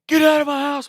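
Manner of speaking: loud, high imitated old man's yell; grandpa screaming. Grandpa with a gun, yelling at the intruder loud and high. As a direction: bellow like an angry armed grandpa. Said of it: "screaming at me".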